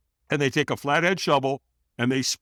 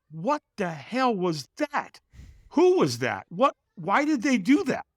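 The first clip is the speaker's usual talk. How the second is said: acted bewilderment, slow start leaping high; mock stunned questioning; baffled reaction; 8 semitones higher, slower than usual. Man reacts to the vanished stranger, acted bafflement.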